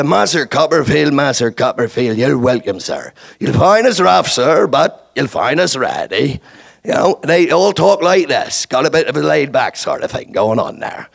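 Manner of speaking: laid-back West Country English, North Devonshire; rolling North Devonshire dialect; North Devonshire accent. Shown as a demonstration. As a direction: talk like an easygoing North Devonshire native. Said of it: "North Devonshire".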